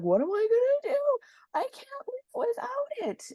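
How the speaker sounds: high, wailing hammed-up despair; mock despair. Losing sugar, high mock wail of despair. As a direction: wail like losing your favourite thing. Retold own despair about pop.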